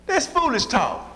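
high-pitched mock scoff; retold incredulous dismissal in a high voice. Crowd scoffing at Jesus's words.

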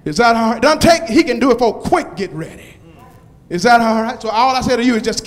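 loud rising preacher's call, building; preaching at full force. Acted. Pastor calling out to the congregation, preaching at full force. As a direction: whoop the promises at the crowd.

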